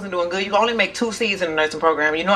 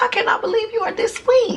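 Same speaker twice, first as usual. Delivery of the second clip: starstruck gush; 13 semitones higher than usual. Retold or imitated. Gushing quote to [name].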